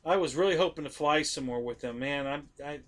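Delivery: holding back tears over a friend's loss; choked up. Real, not acted. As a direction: voice thinning as emotion rises. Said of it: "I'm getting choked up right now".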